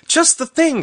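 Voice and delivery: very high, loud exclamation; delighted cry. A narrator's reading. Rose clapping her hands at the parasol idea, crying out. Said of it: "she cried".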